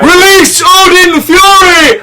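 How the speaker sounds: high, slow booming war cry; epic battle cry. Pretend. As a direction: bellow it like a god unleashing.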